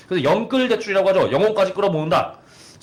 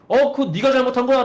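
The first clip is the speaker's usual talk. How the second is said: higher, louder mock rude owner; rude comeback; 8 semitones higher, 4 dB louder than usual. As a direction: snap back as a rude owner. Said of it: "그렇게 세게 나온다". (높고 크게 무례하게 쏘아붙이는 사장 흉내)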